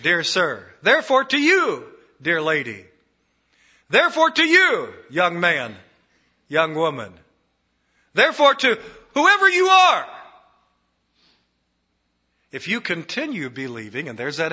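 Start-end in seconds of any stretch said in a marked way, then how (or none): 0.8-1.8 "you" hit higher and louder; stress on you. Shown as a demonstration. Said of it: "Doubly emphasized". 3.9-4.9 "you" hit much higher and louder; stress on you. Shown as a demonstration. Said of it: "Doubly emphasized". 8.1-10.1 "whoever" hit much higher and louder; stress on whoever. Shown as a demonstration. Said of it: "Doubly emphasized".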